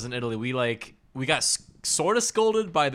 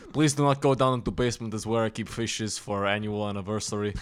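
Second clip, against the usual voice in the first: put-on hospitable host with Russian accent; 3 semitones lower than usual. Russian host welcoming guests into his home, Russian accent. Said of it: "what else would a Russian guy be named".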